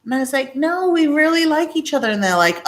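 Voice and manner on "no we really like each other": giddy protest. Retold or imitated. Protesting to laughing coworkers.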